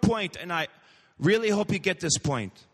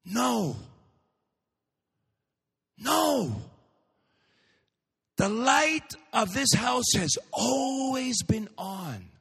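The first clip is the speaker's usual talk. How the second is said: tender; 5 semitones higher, slower than usual. Acted. Mother gently answers daughter.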